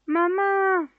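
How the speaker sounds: high, scared cry for mom; the startled fireman in the dark garden, high scared cry; frightened cry. A narrator's reading. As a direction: yelp for your mother in terror. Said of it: "temblando, gritaba".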